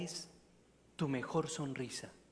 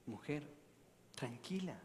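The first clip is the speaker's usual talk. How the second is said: softer, gentle reassurance; calming; 9 dB quieter than usual. Retold or imitated. The priest calming the weeping mother, soft reassurance. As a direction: soothe her quietly.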